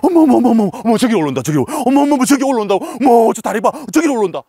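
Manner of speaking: high, loud gushing "어머 어머"; excited women hikers. Retold or imitated. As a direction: gush and squeal over the rider. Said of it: "저를 딱 발견하지".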